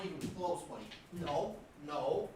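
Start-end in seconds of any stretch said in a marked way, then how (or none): none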